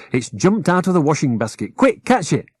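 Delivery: high rag doll shouting quick; Rosie's voice, urgent. Acted.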